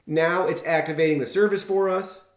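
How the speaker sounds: normal talking voice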